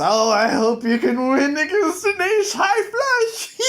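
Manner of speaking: high, laughing mock devil voice; gloating devil. Devil gloats at Jack over his flush, laughing. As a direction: taunt him through a cackle.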